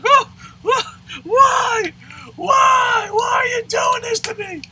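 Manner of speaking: loud, high-pitched comic wail; mock bawling. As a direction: wail it out like an exaggerated crybaby. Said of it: "I was bawling like a bitch".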